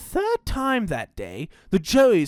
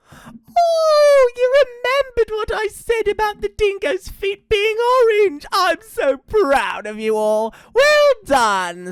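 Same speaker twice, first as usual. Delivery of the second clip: very high, loud sugary falsetto; gushing fake mama; 6 dB louder, slower than usual. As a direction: gush with exaggerated motherly pride, high and loud. Dingo poses as proud mama.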